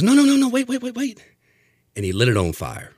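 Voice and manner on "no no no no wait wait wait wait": mock panicked bartender, much higher; a squealing, hammed-up waiter stopping him; imitating the bartender, high and alarmed; frantic bartender. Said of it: "the guy goes".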